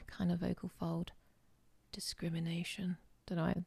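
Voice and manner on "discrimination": breathy, soft whisper; whispered word. Shown as a demonstration. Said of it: "just like that word".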